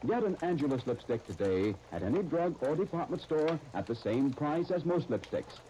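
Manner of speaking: smooth, persuasive commercial pitch; radio ad read. Acted. Announcer selling lipstick to listeners, smooth and persuasive. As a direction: sell the lipstick with polish.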